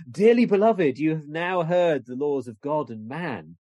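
mock-solemn clergyman's proclamation; London parish minister. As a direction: address the congregation from the pulpit. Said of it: "he reads out the Book of Sports. He says".

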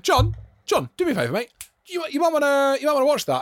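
high-pitched, tense call to a friend; retold urging. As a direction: get his attention before he misses it. Acts out urging friend to watch.

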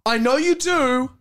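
high, loud drawn-out protest; mock insistent. Phoebe confronting the mum about the bracelet, hammed-up insistence. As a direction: overact a dramatic refusal to be fobbed off.

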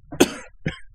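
sharp choking cough; coughing fit. Real, not acted. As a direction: cough hard, clearing the windpipe. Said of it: "ちょっと咳込みます".

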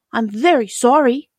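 a hint of a young girl apologising; apologetic girl. A narrator's reading. Dorothy apologises to angry milkmaid.

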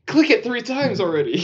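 hammed-up impatience, pushed up in pitch; exasperated. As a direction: plead impatiently as a fed-up viewer. Acted outburst at the film character.

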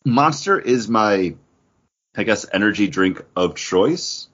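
ordinary talk, nothing marked

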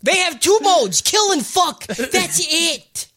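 high, insistent ranting; exasperated mock rant. Goblin Slayer explaining goblins, worked-up rant.

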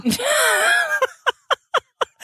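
howling with laughter; shrieking laugh. Real, not acted. Cracking up at the rant.

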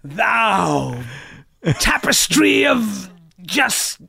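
slow, high, shouted fake Shakespeare curses; cursing old actor. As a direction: bellow made-up curses like King Lear. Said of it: "he'd just be like".

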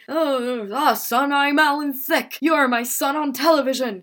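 a hammed-up sitcom father breaking the news; sitcom dad. TV dad tells his son he's only an actor, awkward. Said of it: "And Dad is like".

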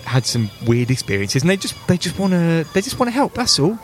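high-pitched, reassuring mum; Janet. Acted. Mum reassures her scared daughter, coaxing high voice. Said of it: "So Janet says".

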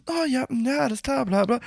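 high, soft mock girl voice. Girl agreeing to pharmacy, high soft mock voice. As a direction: chirp like a dismissive girl. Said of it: "Sie so".

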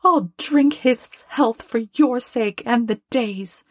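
high-pitched wife giving in grudgingly; high, reluctant toast; grudging Mrs Cratchit. A narrator's reading. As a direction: concede it in a high, put-on voice. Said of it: "said Missus Cratchit".

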